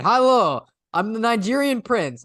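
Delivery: hammed-up Russian accent, pitched high and loud. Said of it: "like, a Russian accent".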